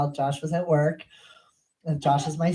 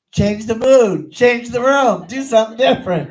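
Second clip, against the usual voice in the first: acted exasperation, raised voice hammering each phrase; exasperated shout; 7 semitones higher, 8 dB louder than usual. Exasperated advice to panicking son.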